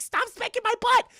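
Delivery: very high, loud squealed protest; mock shriek. Girlfriend slung over his shoulder, shrieking protest. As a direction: squeal it like someone being carried off. Retold protest while being spanked.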